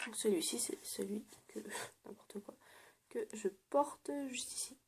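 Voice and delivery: soft unvoiced whisper, close to the mic; hushed whispering while showing a nail polish. Real, not acted. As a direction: whisper as if sharing a secret.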